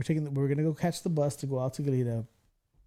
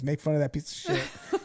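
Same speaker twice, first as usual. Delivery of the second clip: ordinary talk, nothing marked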